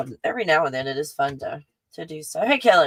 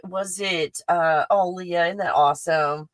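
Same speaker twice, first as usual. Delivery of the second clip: normal talking voice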